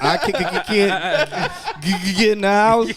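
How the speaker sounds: mock stutter on "can't" and "get"; imitated stutter. Teasing friend over how he reads texts, mock stutter. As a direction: catch repeatedly on the hard consonants. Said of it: "you be like".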